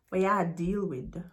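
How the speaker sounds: relaxed, soft Jamaican Patois. Shown as a demonstration. Teacher giving learners the translation, in Jamaican Patois.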